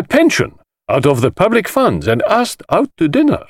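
high, showy voice of a flamboyant collector; theatrical host. Acted. The host lecturing the detective, grand and high-pitched. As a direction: relish every word like a showman.

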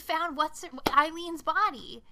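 loud, high exclamation of disbelief; exasperated, forceful objection; indignant outburst. Acted. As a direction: shout it at the screen like an outraged viewer. Voicing what the audience should shout.

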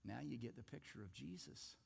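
usual speaking voice

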